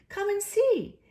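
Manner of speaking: high excited invitation; delighted. A narrator's reading. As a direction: call out, thrilled. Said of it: "said the delighted rat".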